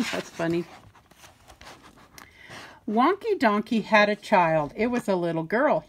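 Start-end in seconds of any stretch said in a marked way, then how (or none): none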